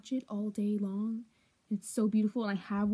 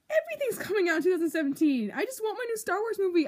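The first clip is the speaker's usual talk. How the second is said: frantic; 8 semitones higher, faster than usual. Real, not acted. Frantic complaint to camera.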